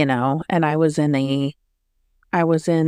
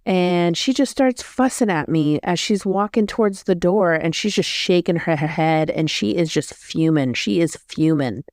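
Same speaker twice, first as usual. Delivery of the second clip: usual speaking voice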